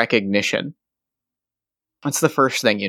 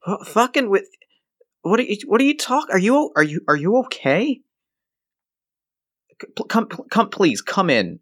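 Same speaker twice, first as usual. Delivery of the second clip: much higher, stammering worry; flustered and alarmed; 8 semitones higher than usual. Acted. Johnny alarmed at Rocco's state, high stammering worry. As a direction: trip over the words, worried.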